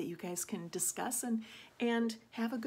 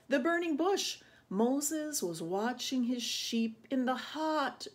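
put-on animated read-aloud for children; story reading; 2 semitones higher than usual. A narrator's reading. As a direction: tell it with suspenseful, stretched key words. Reads Bible story to children.

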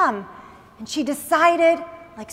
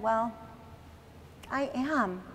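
soft, sad, much quieter; sad admission; 4 semitones lower, 14 dB quieter than usual. Acted. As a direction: sigh it out, deflated. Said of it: "you seem very sad".

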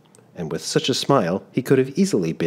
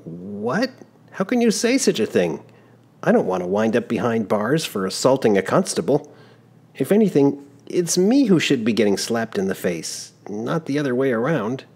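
put-on shocked young traveler; 6 semitones higher than usual. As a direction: object in disbelief. Giovannino protests slap request.

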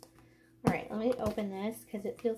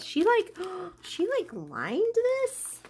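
high, breathless amazement; excited gasp; 7 semitones higher than usual. Real, not acted. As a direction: gasp and marvel at it. Gasps over handmade envelope.

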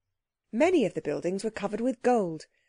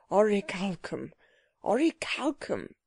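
dazed muttering of one word; dazed repeating. A narrator's reading. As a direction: repeat it as if stunned, to yourself. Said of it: "stumbled along in a dazed way".

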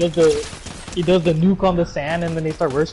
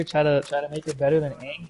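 ordinary talk

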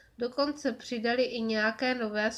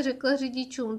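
ordinary delivery

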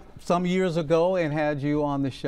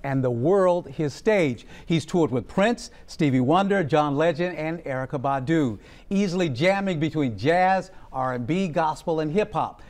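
upbeat presenter read, a little louder; TV host intro; 4 semitones higher, 4 dB louder than usual. Acted. TV host welcoming a harmonica player onto the show, bright announcer read. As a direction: sell the guest to the audience like a show host.

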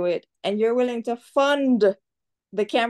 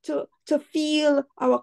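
higher, friendly hawker's call to a tourist; resort braider offer; 6 semitones higher than usual. Retold or imitated. Braider at the resort inviting a tourist, higher friendly call. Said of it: "You go to the resort, it's".

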